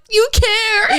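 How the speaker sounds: high, wobbly, teary little question; touched, teary. Retold or imitated. Moved gamer asking her friends, high and teary. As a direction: squeak it out near tears.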